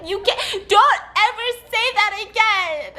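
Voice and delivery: high mock-tearful scolding; hammed-up distraught plea; imitating bawling. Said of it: "And she starts bawling".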